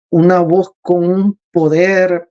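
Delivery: ordinary talk, nothing marked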